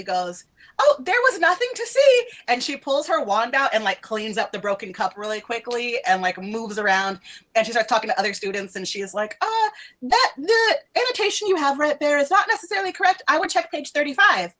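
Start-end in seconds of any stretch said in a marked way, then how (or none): 0.8-2.2 much higher, breezy dismissive teacher voice; airhead professor. Acted. Professor hastily dismissing the students, high breezy voice. 9.4-14.5 much higher, breezy dismissive teacher voice; airhead professor. Acted. Professor hastily dismissing the students, high breezy voice.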